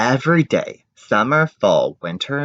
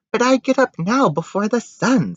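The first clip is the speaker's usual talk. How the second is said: high, whiny protest; girl protesting; 12 semitones higher than usual. Acted. Daughter protesting to her father, high and whiny. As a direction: complain in a small high voice.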